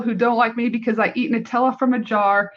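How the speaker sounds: usual speaking voice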